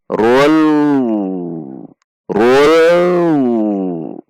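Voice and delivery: very slow "Rul" with a long dark L; slowed demonstration. Shown as a demonstration. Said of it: "jika dilambatkan".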